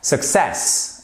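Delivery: "Success" with the stress on the second syllable, suc-CESS; model word. Shown as a demonstration. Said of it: "À vous". (le prof prononce « Success » en modèle, accent sur CESS)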